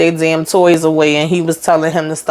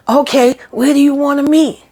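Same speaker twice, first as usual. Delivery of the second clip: high, eager yeah-we-can-meet; giddy and eager; 9 semitones higher than usual. Retold or imitated. Gary eagerly agreeing to meet his ex, high and giddy. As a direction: gush like a smitten man.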